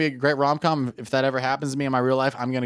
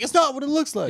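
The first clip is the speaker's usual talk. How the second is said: higher, defensive excuse; higher protest from someone caught red-handed; 12 semitones higher than usual. Retold or imitated. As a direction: plead innocence like you just got caught. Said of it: "You said it in the tone like".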